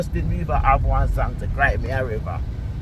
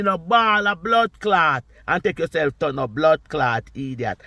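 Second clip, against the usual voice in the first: usual speaking voice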